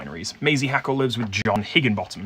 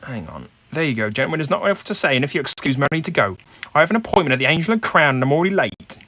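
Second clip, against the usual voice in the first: higher, louder, brisk woman brushing off the question; brisk dismissive Maisie; 4 semitones higher, 4 dB louder than usual. Acted. Game master voices Maisie as she rushes off to an appointment, brisk and dismissive. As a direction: hurry them out the door, dismissive.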